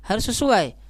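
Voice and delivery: ordinary talk, nothing marked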